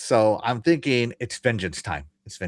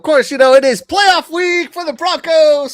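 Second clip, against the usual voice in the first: high, loud sports-fan hype; excited shout; 14 semitones higher, 9 dB louder than usual. Real, not acted. Hyping up team's playoff week.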